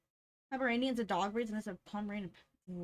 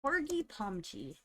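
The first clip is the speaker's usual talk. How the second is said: ordinary delivery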